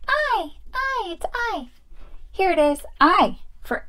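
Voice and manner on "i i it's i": high, small doll voice; Peach. Pretend. Doll answering the teacher, high-voiced pupil. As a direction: squeak out the answer as a toy pupil.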